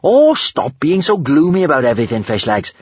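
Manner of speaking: high, carefree scolding; airy, upbeat telling-off; breezy. A narrator's reading. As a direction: brush off the gloom, starting high and easing down. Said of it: "said Kamikaze breezily".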